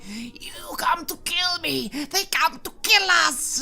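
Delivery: very high, drawn-out frantic screech; screaming creature. Acted. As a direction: scream it out like a chained bat creature.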